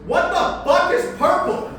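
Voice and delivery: high, loud outburst; exasperated high-pitched shout; baffled yell. Acted. Baffled punchline after listing flag colours.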